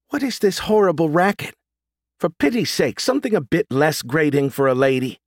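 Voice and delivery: high, prissy grandmother voice, irritated; a fussy old lady offended by noise; prim old lady. Acted. Priscilla plays prim grandma.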